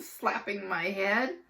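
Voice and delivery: usual speaking voice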